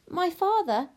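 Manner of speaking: high, emphatic princess voice; haughty princess. Acted. Princess invokes her father the king, haughty. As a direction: announce it high and important.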